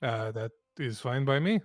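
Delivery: put-on light Eastern European accent; Vlad voice. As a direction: lean into a thick Vlad accent. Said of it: "I'm doing the Vlad voice for this guy".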